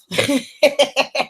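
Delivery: a high, light laugh of delight; high giggle. Real, not acted. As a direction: giggle happily at the viewer. Laughing at Korea viewer.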